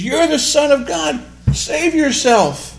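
high, loud, slow mock taunt; mocking Pharisees. Pharisees jeering at Jesus on the cross, mocking. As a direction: jeer it with scorn. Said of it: "The Pharisees".

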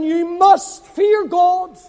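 ordinary delivery

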